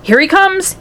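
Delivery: high-pitched excited yell; lightly acted yell. A narrator's reading. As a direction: announce it excitedly, pitch leaping. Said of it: "yelled Mister Wonka".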